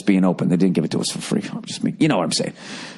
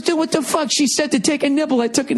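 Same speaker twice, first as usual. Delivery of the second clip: high, defensive outraged voice; indignant friend. Retold or imitated. Friend defends his bite, high indignant whine. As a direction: play the buddy blaming the waitress. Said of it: "He goes".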